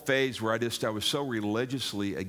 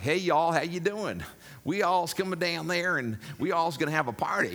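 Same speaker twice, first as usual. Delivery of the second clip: a high, exaggerated Southern twang; Texas twang; 7 semitones higher than usual. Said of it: "that twang".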